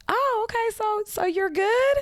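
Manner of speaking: high, careful check-in; cautious relief. Retold or imitated. Checking on her friend, high and cautious. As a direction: tiptoe around the news.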